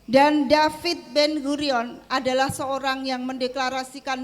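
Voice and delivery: loud, emphatic oratory; real public orator building each phrase; impassioned speech. Real, not acted.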